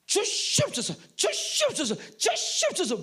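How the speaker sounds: high, loud repeated begging; mock loud pleading. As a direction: beg at full force, mockingly. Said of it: "세게 해서 주실 것 같으면".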